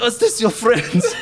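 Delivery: German backpacker voice. Retold or imitated.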